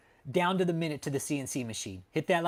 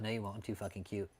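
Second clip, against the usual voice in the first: soft cute quote; 7 semitones lower, 12 dB quieter, faster than usual. Retold or imitated. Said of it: "She said".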